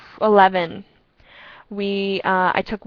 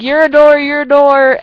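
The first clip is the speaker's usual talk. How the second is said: mocking shout; 6 semitones higher, 10 dB louder, slower than usual. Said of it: "we're like".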